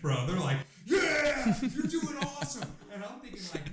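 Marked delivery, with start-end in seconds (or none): 0.8-2.7 high, hyped cornerman cheer; amped-up buddies. Retold or imitated. Buddies cheering their tired fighter in the corner, amped and high. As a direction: cheer him on, pitched way up. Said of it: "My buddies are fucking amped".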